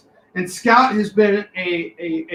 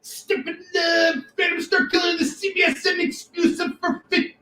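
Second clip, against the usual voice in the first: high hammed-up sales shout; hype pitch; 8 semitones higher than usual. Host hyping a comic deal to viewers, high excited shout. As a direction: sell it like a carnival barker.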